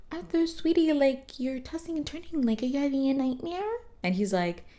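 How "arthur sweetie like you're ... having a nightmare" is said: much higher, sugary motherly voice; sweet mom voice. Retold or imitated. Mom soothing Arthur at night, cooing high. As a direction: coo it like a doting mom.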